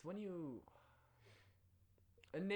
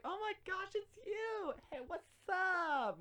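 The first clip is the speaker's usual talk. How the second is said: put-on much higher, louder, slow gushing girl voice; bubbly frat girl; 5 dB louder, slower than usual. Student teacher greets him gushing like a frat girl. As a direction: gush like an excited frat girl. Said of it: "like a frat girl".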